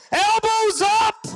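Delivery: high, slow, drawn-out rallying shout; hammed-up battle cry to his wife. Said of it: "I said, "Shelby".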